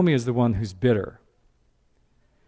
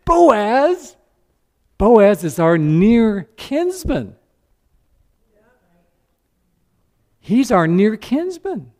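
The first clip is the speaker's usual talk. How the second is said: much higher, louder and slow; thrilled; 9 semitones higher, 7 dB louder, much slower than usual. Acted. Naomi realising Boaz is their near kinsman, slow and thrilled. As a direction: savour the news with delight.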